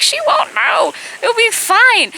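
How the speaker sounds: very high-pitched imitation of brushing off worries; retold coaxing, voice way up high; mock breezy insistence in a high voice; dismissive reassurance.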